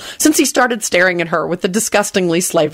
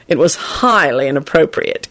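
grave; 2 semitones lower than usual. A narrator's reading. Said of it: "Kingsley replied gravely".

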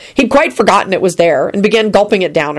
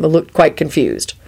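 plain reading voice

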